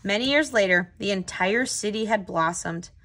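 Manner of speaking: put-on steady read-aloud voice for young listeners; unhurried children's story narration; storybook narration. A narrator's reading. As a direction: read it like a picture book to a class. Reads picture book aloud.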